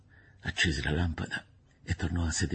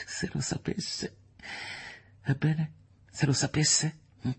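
dismissive; 10 semitones higher than usual. Acted. Woman speaking of her cousin finding out, dismissive. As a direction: lightly laugh off the worry.

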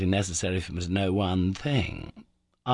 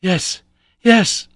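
loud, higher shout from far off; shouted answer; 11 semitones higher, 11 dB louder than usual. Acted. Lost nephew answering his uncle, a loud distant shout. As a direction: shout back so it carries.

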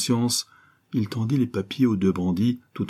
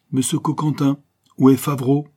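high, loud, menacing demand; harsh, almost menacing; 6 semitones higher, 6 dB louder than usual. A narrator's reading. Adventuress suddenly confronts the detective over a missing man, harsh and menacing. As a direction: confront them sharply, almost threatening. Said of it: "d'une voix âpre et presque menaçante".

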